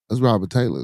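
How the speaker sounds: ordinary talk, nothing marked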